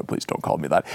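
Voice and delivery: very deep, soft voice; Dick Cheney. Acted. Cheney objects to being called Dick, very deep voice. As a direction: sink to the bottom of your range, quietly. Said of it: "in a very deep voice like this".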